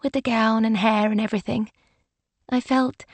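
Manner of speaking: drowsy, half-awake murmur; sleepy voice. A narrator's reading. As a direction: speak like just woken. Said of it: "Alice said in a sleepy voice".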